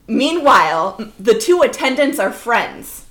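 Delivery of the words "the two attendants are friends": ordinary talk, nothing marked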